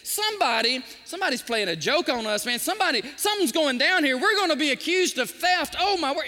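preacher voicing brothers in a panic; mock panic. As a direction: play the brothers freaking out. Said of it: "Those brothers are freaking out".

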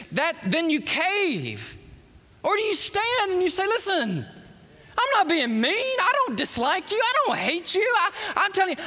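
high, ringing pulpit preaching; fiery preaching. Acted. Preacher urging the friend to stand for God, fervent and high.

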